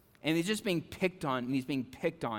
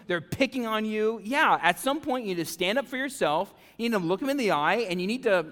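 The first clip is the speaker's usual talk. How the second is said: put-on louder and higher, egging the kid on; fired-up teen bravado; 6 semitones higher, 6 dB louder than usual. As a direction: pump him up like a brash fourteen-year-old. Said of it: "I'm like".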